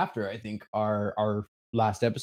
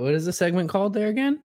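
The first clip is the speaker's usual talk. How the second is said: playful; 11 semitones higher, faster than usual. Acted. Teasing intro to the segment.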